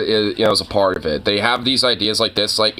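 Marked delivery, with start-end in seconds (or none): none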